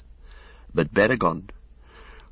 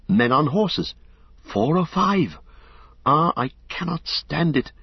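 higher, breathless panic as the riders swoop; rising terror; 6 semitones higher than usual. A narrator's reading. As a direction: voice climbing with dread. Pippin terrified watching Nazgul.

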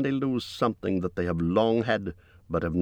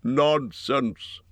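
a touch of lordly firmness, a little higher; dignified dismissal; 5 semitones higher than usual. A narrator's reading. Thorin recovering his dignity, dismissing the dwarves' despair firmly. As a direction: brush it off with regained composure. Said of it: "said Thorin, recovering his dignity".

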